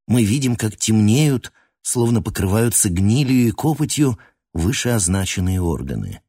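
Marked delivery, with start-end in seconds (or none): none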